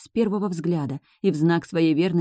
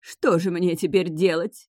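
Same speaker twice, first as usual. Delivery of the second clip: ordinary delivery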